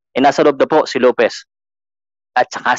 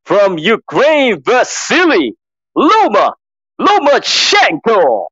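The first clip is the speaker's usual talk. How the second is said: Mark Chinook; 5 semitones lower than usual. Acted. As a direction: ring announcer building to long held words. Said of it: "I'm just copying Mark Chinook".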